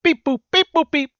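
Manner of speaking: high beeping droid chirps; robot voice. Pretend. Droid introduced, robot noises.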